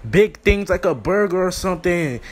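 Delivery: usual speaking voice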